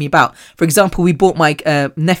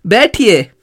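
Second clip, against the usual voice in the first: calling a friend over; 5 semitones higher, slower than usual. Retold or imitated. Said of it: "And then I was like".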